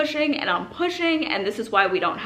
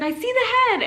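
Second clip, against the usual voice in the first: higher, louder announcement; excited; 5 dB louder than usual. Retold or imitated. Doctor telling the mother he sees the head, excited. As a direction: call it out with excitement.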